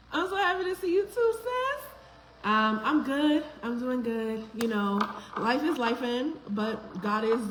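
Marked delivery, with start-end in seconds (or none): 0.1-1.9 quick, high gushing thanks; excited reply. Real, not acted. Host answering a viewer's hello, high and gushing. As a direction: squeal it back to your sister-friend.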